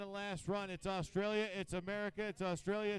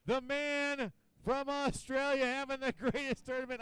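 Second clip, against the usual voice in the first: raised, loud, stretched-out names; big announcer introduction; 6 semitones higher, 7 dB louder than usual. Real, not acted. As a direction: present the champion to the crowd. Announces the skier.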